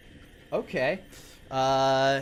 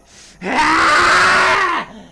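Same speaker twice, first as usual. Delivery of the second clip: long, loud high shriek; acted death scream; 14 semitones higher, 12 dB louder than usual. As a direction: shriek as you are turned to dust. Sound of disintegration after narration.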